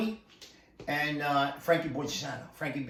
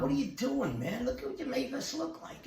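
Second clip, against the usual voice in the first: higher-pitched, incredulous scolding; retold remonstration; 9 semitones higher, 3 dB quieter than usual. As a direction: call a friend out, voice pitched up. Acted quote, scolding his friend.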